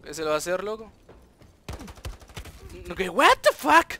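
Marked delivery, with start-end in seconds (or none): 2.9-3.9 slow, loud outraged yell; disbelieving shout. Real, not acted. Baffling death in game.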